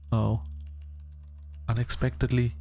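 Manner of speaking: ordinary talk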